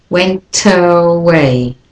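Went away slowly, T of went run into the uh of away; linked slowly. Shown as a demonstration. Teacher slowly models linking went and away. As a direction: Went away joined with no gap. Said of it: "you can do a link".

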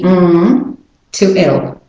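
normal talking voice

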